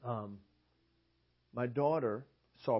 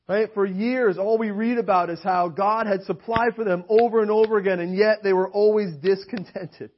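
raised, emphatic preaching voice; 7 semitones higher, 9 dB louder than usual. Acted. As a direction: preach it out to the congregation, building. Preaching to the congregation.

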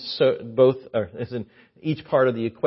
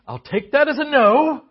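mock reply; 12 semitones higher, 9 dB louder than usual. Joke reply in high voice.

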